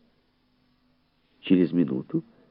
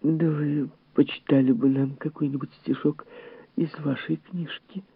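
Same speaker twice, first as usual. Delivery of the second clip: high-voiced gentle request; coaxing lady guest; 11 semitones higher than usual. A narrator's reading. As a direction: ask politely in a pitched-up lady's voice.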